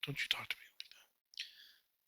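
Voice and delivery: slow hushed mock offense; whispered fake outrage; mock offended. Mock offense after sibling jab.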